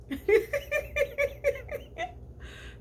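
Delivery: long high rising laugh. Real, not acted. [name] laughs at her own crying, high rising laugh. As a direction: burst into a high laugh at herself.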